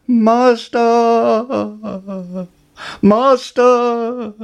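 high, loud shrill alarm cry; magic harp crying out. Acted. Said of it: "the harp cried".

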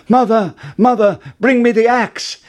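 high, loud cry for help; frantic storybook shout; urgent calling out. Acted. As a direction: yell for it with no time to lose. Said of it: "called out".

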